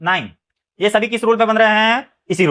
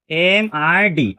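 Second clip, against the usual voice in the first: slow, drawn-out letters एम, आई, डी; letter-by-letter teaching pace for एम आई डी; spelled out slowly; 2 semitones lower, much slower than usual. Shown as a demonstration. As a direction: stretch each letter of एम आई डी apart.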